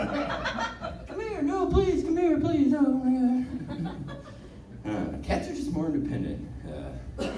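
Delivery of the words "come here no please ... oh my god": high, soft, coaxing plea; pleading with cat. Pretend. Owner pleading with aloof cat, soft and high. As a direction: coax the cat back, gently begging. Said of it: "owner pleading with cat".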